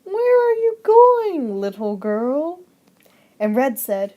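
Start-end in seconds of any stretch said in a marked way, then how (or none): 0.0-2.6 high, slow sly wolf; a high, slow wolf calls to the little girl. Acted. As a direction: draw it out sweetly like a wolf luring a girl. Wolf asks Red, story voice.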